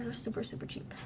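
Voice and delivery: hushed aside; whispering. Real, not acted. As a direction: whisper it like a secret. Whispered aside about cheap buckets.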